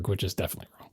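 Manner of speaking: usual speaking voice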